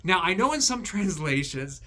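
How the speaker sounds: ordinary delivery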